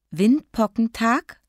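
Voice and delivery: put-on slow, high, questioning child; tearful puzzlement. A narrator's reading. Sniffling child asking Mama about the plan, puzzled. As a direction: echo the new word slowly, rising in doubt. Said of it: "Kathi schniefte".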